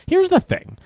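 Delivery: high emphatic pitch jump. Real, not acted. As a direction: spike up to grab attention. Emphatic setup.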